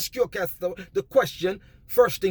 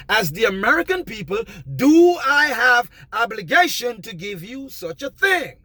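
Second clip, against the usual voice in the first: firm loud demand; 5 semitones higher, 5 dB louder than usual. Shown as a demonstration. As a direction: demand it of the officer with full force. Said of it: "Keep re-, rehearsing that to them".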